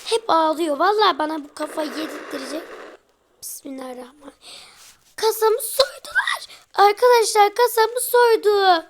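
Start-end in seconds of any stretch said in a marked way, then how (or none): 3.4-4.3 murmured whispered prayer; quick whispered bismillah. Real, not acted. Whispered prayer before game event. 5.1-8.9 high, loud cry of outrage; shouting that his safe was robbed; outraged shout. Real, not acted. Discovers safe robbed in game.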